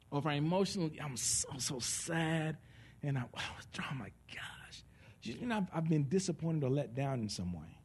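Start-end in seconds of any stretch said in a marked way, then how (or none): 1.0-4.8 acted sadness. Acting out sadness.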